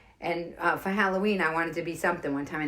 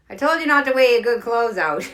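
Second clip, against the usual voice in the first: loud, raised mock scolding; upset Irish mother; 6 semitones higher, 10 dB louder than usual. Mother to her beaten-up kid, upset scolding. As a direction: scold like an exasperated mom. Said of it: "she was just upset".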